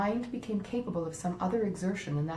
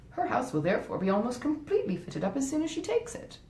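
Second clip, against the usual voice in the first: lightly voiced wife, higher and coaxing; scheming wife; 6 semitones higher, 3 dB louder than usual. Wife talking her husband out of helping his stepmother, coaxing. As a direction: talk him out of the money, sweetly persuasive. Fanny continues arguing.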